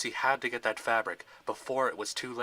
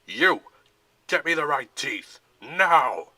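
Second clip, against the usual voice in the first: barked orders; 3 semitones higher, 11 dB louder, slower than usual. A narrator's reading. General to a guard, loud barked orders. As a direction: bark each word.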